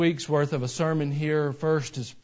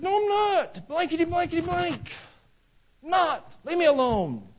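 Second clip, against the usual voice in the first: hammed-up furious denial, very high-pitched; mad, cussing outburst; 4 dB louder than usual. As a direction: blow up in a mock cursing fit. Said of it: "Peter got so mad that he".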